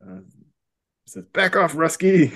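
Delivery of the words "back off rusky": higher-pitched mock challenge; mock warning. Gray strongman warns off a supposed Russian, mock challenge. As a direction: warn him off in a raised, playful voice.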